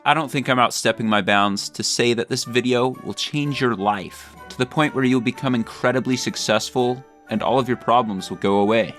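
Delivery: ordinary talk, nothing marked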